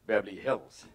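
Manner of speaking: low, soft mock-casual reply; offhand boast. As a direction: drop it casually with a laugh. Plays his own boastful offhand answer.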